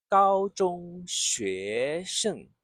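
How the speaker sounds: gao zhong xue sheng stretched out, each tone clear; very slow model. Shown as a demonstration. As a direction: draw the phrase out slowly. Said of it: "both tone one and two together".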